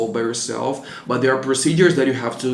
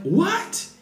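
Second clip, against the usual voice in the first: high, incredulous "What?"; disbelieving what. Retold or imitated. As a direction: squeak it out in total disbelief. Stunned at guest's tea request.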